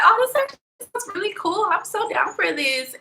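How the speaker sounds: high, thrilled and eager; excited. Retold or imitated. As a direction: squeal it out like great news. Retelling her first excited reaction.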